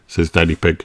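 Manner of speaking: ordinary delivery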